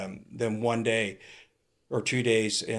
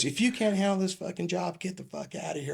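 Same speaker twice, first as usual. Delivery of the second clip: higher-pitched scolding, not shouted; imitated chewing-out; 4 semitones higher, 4 dB quieter than usual. Sergeant ripping the new officer, mildly mock angry. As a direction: retell a sergeant's dressing-down, starting high. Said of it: "and just ripped me".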